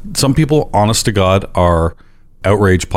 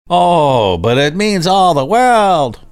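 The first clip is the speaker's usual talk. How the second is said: slow, high mock-nerd whine, drawn out; nerdy voice; 7 semitones higher, much slower than usual. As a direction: play the earnest pinball nerd, stretching each word. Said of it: "I love your nerdy voice".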